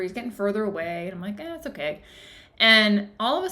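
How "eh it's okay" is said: soft, higher-pitched dismissive shrug; casual brush-off. Retold or imitated. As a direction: shrug it off lightly. Retells brushing off worry.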